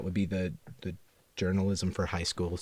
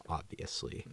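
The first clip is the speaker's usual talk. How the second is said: whispered; 3 semitones lower, 12 dB quieter than usual. Acted. Acronym bit, breathy aside.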